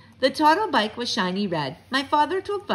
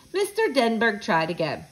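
usual speaking voice

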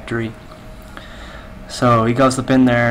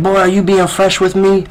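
louder menacing robber; mock robber threat; 8 semitones higher, 5 dB louder than usual. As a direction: bark it at the cashier.

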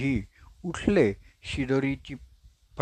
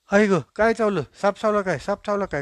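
high alarmed yelp, then fading to a worried question; startled cry; 7 semitones higher, 3 dB louder, faster than usual. Acted. Friend waking from his nap cries out in alarm, high and startled. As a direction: jolt awake sure a snake bit you.

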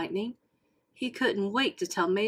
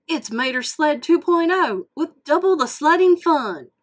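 higher, loud proud announcement; excited cry; 7 semitones higher, 8 dB louder than usual. Acted. Mater presenting the new sled to Lightning, loud. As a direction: show it off with pride. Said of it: "cried Mater".

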